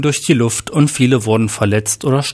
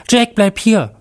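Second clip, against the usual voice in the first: high-pitched urgent call; shouting after someone; 9 semitones higher than usual. A narrator's reading. Husband shouts after his man running back, urgent. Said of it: "schrie Mark seinem Mann hinterher".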